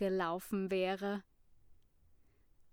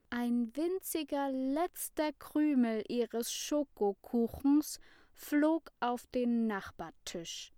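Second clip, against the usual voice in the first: usual speaking voice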